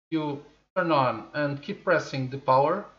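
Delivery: normal talking voice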